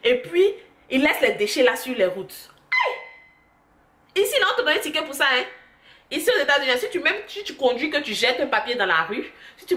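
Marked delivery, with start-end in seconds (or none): 2.7-4.2 high shrill aie of disgust; shocked cry. Real, not acted. About dog owners leaving mess, high shrill cry. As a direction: yelp in disgust.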